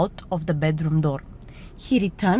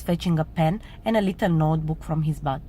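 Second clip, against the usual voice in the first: ordinary delivery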